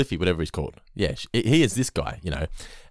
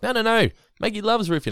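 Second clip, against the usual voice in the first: higher-pitched mock protest; lightly voiced protesting mother; 12 semitones higher than usual. Acting out Marge's protest.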